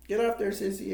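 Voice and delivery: high-pitched imitated command; mock order. Bud ordering Sissy off bull.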